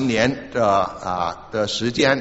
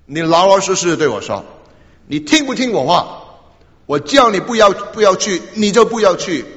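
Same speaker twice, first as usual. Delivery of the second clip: higher and louder, a father scolding his son; a stern father demands obedience and grows louder; angry father demands; 5 semitones higher, 4 dB louder than usual. Retold or imitated. Father confronting the son who will not obey, a raised, scolding voice.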